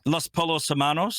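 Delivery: "Polos" with an English l, like polo shirts; mispronounced Spanish. Real, not acted. Australian checks his Spanish with co-host, mispronounced "Polos". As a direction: say "Polos" as POH-lohs, l sounded. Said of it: "Did you say Los Polos?".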